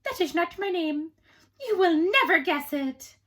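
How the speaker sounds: little man taunts the queen about his name; little man gloating. A narrator's reading. Gloating imp taunting queen, high and loud. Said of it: "the man said".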